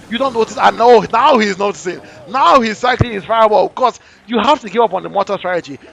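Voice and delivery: loud, fast caster hype; live play-by-play. Real, not acted. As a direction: call the match live.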